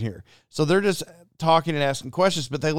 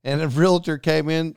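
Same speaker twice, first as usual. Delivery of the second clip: ordinary talk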